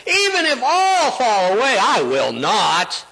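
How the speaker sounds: high, insistent protest, slowing at the end; acted-out boastful vow; Peter.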